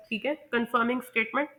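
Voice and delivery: normal talking voice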